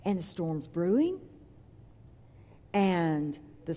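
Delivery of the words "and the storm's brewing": usual speaking voice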